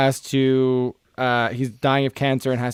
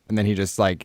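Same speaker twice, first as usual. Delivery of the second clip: ordinary talk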